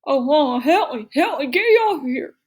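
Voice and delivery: high, loud garbled pleading; mouth stuck. A narrator's reading. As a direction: yell for help with lips stuck fast. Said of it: "like he had something in his mouth".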